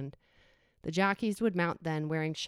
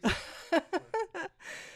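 high laugh; 12 semitones higher than usual. Real, not acted. Laughs at Chicago accent request.

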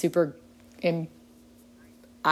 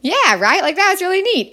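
excited; 13 semitones higher, 7 dB louder than usual. Real, not acted. Proud of sister's new committee.

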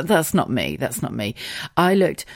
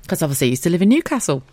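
normal talking voice

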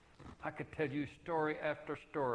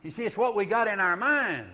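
loud, higher push on the point; loud preaching; 9 semitones higher, 11 dB louder than usual. Real, not acted. Preacher hammering his point to the church, loud. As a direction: drive it home to the congregation.

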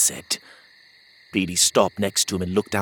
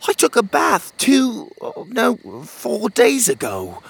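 put-on much higher, louder kid protest; a little boy insisting he's clean; young boy; 12 semitones higher, 5 dB louder than usual. Pockets protesting to Otis, high indignant kid voice.

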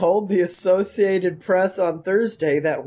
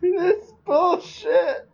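mock crying; 11 semitones higher, much slower than usual. Mock lament over lost Pokemon.

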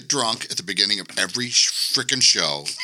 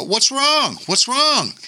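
worried question; slower than usual. Retold or imitated. Retold panicked question to friend.

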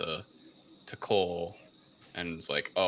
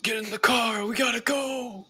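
much higher, louder hurried yell; urgent shout; 5 dB louder than usual. Retold or imitated. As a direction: bark the order to flee, high and loud. Jax urgently calling to family.

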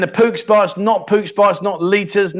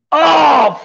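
surprised shout; 10 semitones higher, 5 dB louder than usual. Real, not acted. Reacting to a guess on stream.

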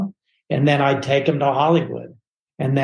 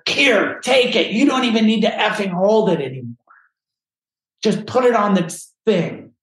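high, exasperated imitation of anger; retold mad friend; 7 semitones higher than usual. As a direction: thrust out orders like a fed-up friend. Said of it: "was all mad".

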